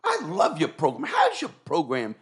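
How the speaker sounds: high, slow, gushing judge greeting; imitating the judge. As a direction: fawn over him like a delighted fan. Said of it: "The judge goes".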